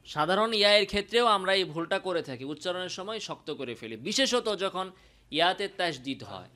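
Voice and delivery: ordinary delivery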